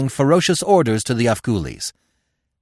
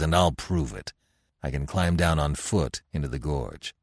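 lower, blunt barbarian chief; Conan; 7 semitones lower than usual. Acted. Conan telling Devi he must go down to his men, blunt low voice. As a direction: speak it bluntly, a chief set on going back to his men.

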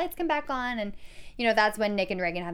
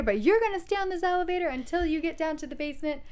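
cop voice; 6 semitones higher, faster than usual. Retold or imitated. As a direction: order them around like a cop. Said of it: "in his cop voice".

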